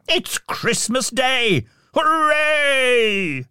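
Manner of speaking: joyful cheer. A narrator's reading.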